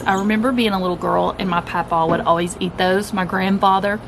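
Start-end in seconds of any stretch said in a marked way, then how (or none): none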